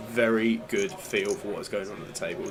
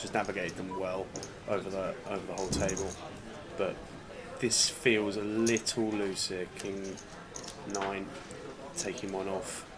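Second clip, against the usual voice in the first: hushed poker commentary; 5 dB quieter than usual. Acted. Quiet play-by-play commentary.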